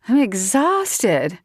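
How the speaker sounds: slow sleepy mock yawn. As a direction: drag it out sleepily. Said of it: "She yawned".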